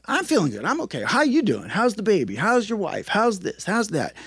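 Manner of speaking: lightly voiced friend brushing off concern; cheery deflecting. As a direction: friend turning questions back on Rick. Imitating Vince deflecting questions.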